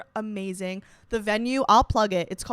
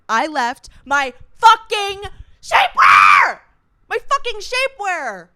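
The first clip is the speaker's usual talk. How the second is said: high, loud cry of outrage; outraged shout; 10 semitones higher, 14 dB louder, slower than usual. Real, not acted. Storyteller lamenting her forgotten shapewear, outraged shouting. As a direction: belt it out in exasperated despair.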